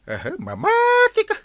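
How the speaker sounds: surprised. Acted. Surprised reaction to news.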